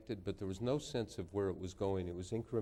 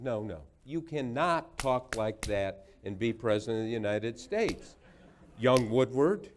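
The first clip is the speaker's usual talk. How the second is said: retold dressing-down, louder and emphatic; worked-up scolding; 3 semitones higher, 7 dB louder than usual. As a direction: imitate a senator sternly putting a young reporter in his place. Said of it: "that was the tone".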